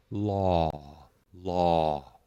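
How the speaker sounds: "law" held even and open from start to end; steady aw. Shown as a demonstration. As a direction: keep "law" steady, no drop. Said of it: "the same sound and the same presence".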